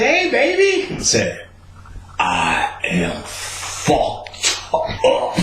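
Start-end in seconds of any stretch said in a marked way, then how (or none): 0.0-0.9 put-on very high, sing-song hey baby; sweet girlfriend voice. Khadijah greeting him outside, high sing-song coo. 2.2-5.4 very slow, low, softer slur; slow stoned drawl. Retold or imitated. Stoned confession.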